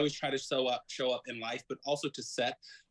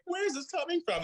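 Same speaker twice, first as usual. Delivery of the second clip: much higher, shocked where is this coming from; astonished question. Retold or imitated. Friend stunned by her sudden weeping, high and surprised. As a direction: sound caught off guard by the tears. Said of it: "he's like".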